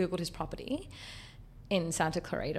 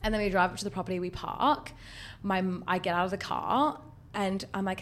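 usual speaking voice

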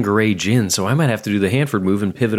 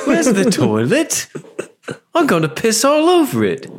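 hammed-up old-lady voice, pitched way up; mock British old lady; 12 semitones higher than usual. Co-host parodies the old lady asking, mock British. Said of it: "We liked when she said it".

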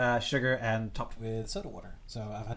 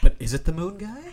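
sudden doubt; 7 semitones higher, 11 dB louder than usual. Retold or imitated. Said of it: "I was like".

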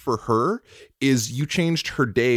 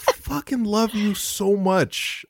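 proud tears; 10 semitones higher than usual. Real, not acted. Tearful declaration of love.

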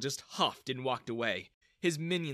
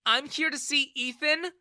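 high, raised, indignant voice; angry reply; 12 semitones higher, 6 dB louder than usual. A narrator's reading. Daughter confronts father over her friend, angry. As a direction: answer him back crossly. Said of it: "she replied angrily".

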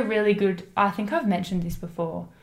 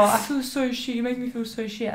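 mock whiny boyfriend; 4 semitones higher than usual. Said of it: "He said to me before".

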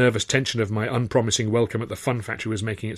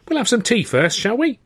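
chatty, higher motherly voice; cheerful landlady; 12 semitones higher than usual. A narrator's reading. Cockney landlady welcoming new lodger, chatty and high. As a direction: bustle and chatter kindly. Clara's voice.

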